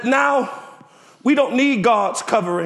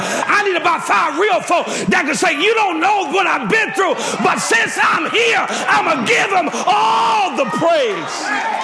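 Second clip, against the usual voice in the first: preaching at full force; 6 semitones higher, slower than usual. Acted. Pastor leading the church in a shout of praise, preaching at full force. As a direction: stretch the praise line out like a hallelujah.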